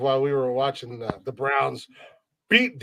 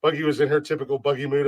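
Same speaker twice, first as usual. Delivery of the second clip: usual speaking voice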